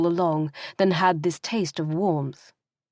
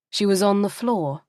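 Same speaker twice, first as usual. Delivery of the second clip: ordinary delivery, nothing marked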